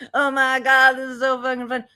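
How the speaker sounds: a hint of stoned glee; imitating stoned woman. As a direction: lightly mimic someone happily high. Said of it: "she's high and she's going".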